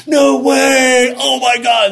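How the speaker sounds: shocked shout. Retold or imitated. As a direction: shout it in disbelief, very high. Coworkers stunned at his answer.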